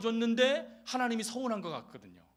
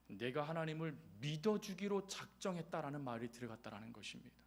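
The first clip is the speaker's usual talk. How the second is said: normal talking voice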